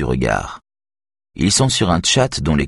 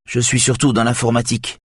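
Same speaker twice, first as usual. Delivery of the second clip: apologetic; 7 semitones higher than usual. A narrator's reading. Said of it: "comme pour s'excuser".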